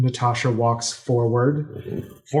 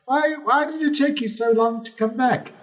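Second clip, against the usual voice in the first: a high, eager king overjoyed to see her; gushing pirate king. Acted. Pirate king welcoming back his lost love, high and gushing. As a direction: beam out the welcome like an old flame.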